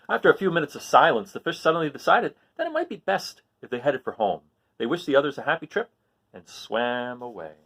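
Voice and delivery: lively read-aloud storytelling; story narration. A narrator's reading. Teacher reading a story to the class, lively narration. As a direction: read it like a picture book to kids. Reads a picture book aloud.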